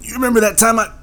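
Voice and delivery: high, strained deathbed voice; dying dad. Acted. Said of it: "when he's on his deathbed, he's gonna be like".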